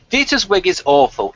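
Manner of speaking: normal talking voice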